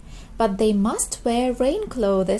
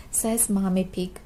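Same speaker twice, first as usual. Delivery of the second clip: ordinary delivery, nothing marked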